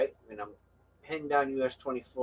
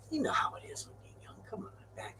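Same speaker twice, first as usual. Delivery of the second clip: whisper; 11 semitones higher, 13 dB quieter, faster than usual. Real, not acted. Confiding aside to the listener.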